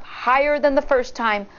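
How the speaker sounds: plain reading voice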